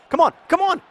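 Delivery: urging shout. Real, not acted. Urging players during play.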